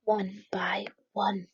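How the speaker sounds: hushed storyteller whisper, slow; slow secretive whisper like a sneaking bunny. A narrator's reading. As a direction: breathe it out secretly, slowly. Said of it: "quietly hid".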